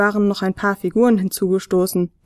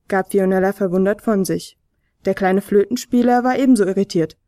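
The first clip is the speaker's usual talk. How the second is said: ordinary delivery, nothing marked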